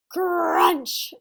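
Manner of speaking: loud crunch sound effect. Acted. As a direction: punch the crunch loudly. Sammy bites the table.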